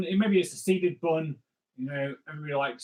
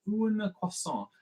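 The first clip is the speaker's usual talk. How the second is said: slow, higher French phrase; 5 semitones higher, 3 dB quieter, slower than usual. Shown as a demonstration. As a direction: draw the French words out slowly. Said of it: "fluent French like I do".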